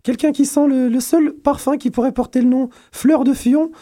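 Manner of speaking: very high teasing presenter voice; mock grand intro. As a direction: hype up a guest, squeaky and high. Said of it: "il a une voix d'ogre". (présentation moqueuse, voix très aiguë)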